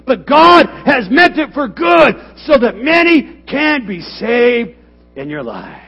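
loud, high, building to a shout; full-force preaching. Acted. Pastor urging church that God meant it for good, shouting. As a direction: build it up and cry it out.

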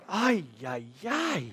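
exasperated groan. Retold or imitated. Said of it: "I'm like".

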